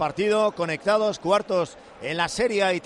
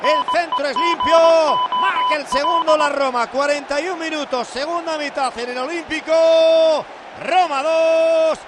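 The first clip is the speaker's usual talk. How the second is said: high, urgent play-by-play; excited goal call; 6 semitones higher, 3 dB louder, slower than usual. Real, not acted. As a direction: call the goal live, stretching the score. Live play-by-play after goal.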